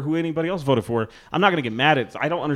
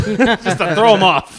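laughing; 7 semitones higher, 7 dB louder, slower than usual. Real, not acted. Laughing at own prank vote.

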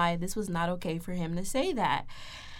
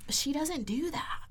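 slow, soft, breath-only retort; imitated whisper; 6 semitones higher, 9 dB quieter, slower than usual. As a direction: whisper it slowly, imitating someone. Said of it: "she go back out there talking about some".

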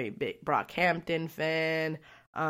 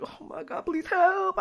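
mock panic; 12 semitones higher, slower than usual. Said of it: "I was a little high".

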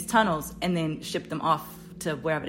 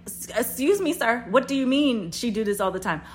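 high, louder incredulous challenge; indignant confrontation; 6 semitones higher, 5 dB louder than usual. Retold or imitated. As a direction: re-enact stopping him to demand answers. Confronting the man in a retelling.

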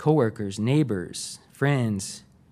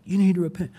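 higher-pitched mimicked street-preacher yell; mock yell; 6 semitones higher, faster than usual. Street preacher at passengers on the platform, mock yell. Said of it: "yelling at people".

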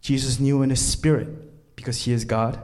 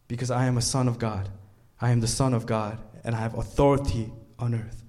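ordinary delivery

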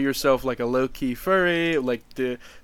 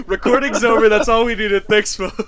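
high, giggling mock sign-off; laughing teasing; 9 semitones higher, 6 dB louder than usual. Real, not acted. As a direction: laugh through a fake goodbye.

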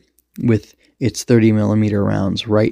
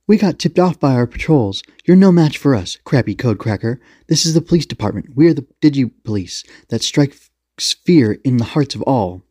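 higher, theatrical police challenge; police commander's warning; 5 semitones higher than usual. Acted. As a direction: announce yourself as the feared police to intimidate.

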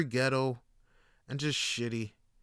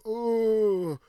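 put-on higher, strained old man voice; imitating a frail old man straining to lift; old man struggling; 7 semitones higher, 4 dB louder, slower than usual. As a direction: groan it out like a frail old worker.

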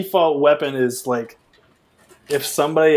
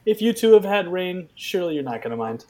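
higher-pitched announcer address to the audience; mock announcer; 6 semitones higher than usual. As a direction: play the host apologising to listeners. Mock announcement to listeners about rain.

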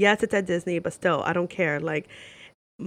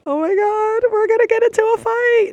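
loud, very high panicky wail; hammed-up alarm, pitched way up and loud; mock panic; 9 dB louder than usual. Stranger with toucan tattoo chimes in, mock panic.

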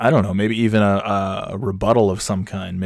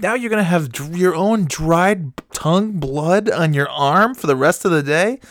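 lightly voiced disgusted wife; 9 semitones higher, 3 dB louder than usual. Wife questioning husband about blood on his arm, mock disbelief. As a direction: imitate a wife's incredulous question.

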